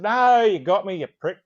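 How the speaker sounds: loud, high imitated protest; exasperated retort. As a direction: act out a wounded, loud comeback. Retells narrator's emotional retort.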